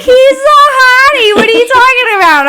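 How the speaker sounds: exaggerated high voice of an outraged friend; imitated indignant woman. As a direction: mimic her protest, high and loud. Retelling another woman's protest.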